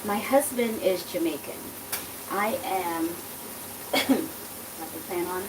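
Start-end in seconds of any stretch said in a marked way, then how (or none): none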